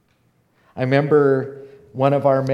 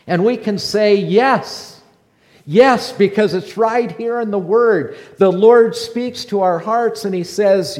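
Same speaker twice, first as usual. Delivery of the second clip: loud, high, building sermon climax; fervent preaching; 7 semitones higher, 7 dB louder than usual. Acted. Preacher answering doubts for the church, loud rising proclamation. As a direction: proclaim each yes louder to the flock.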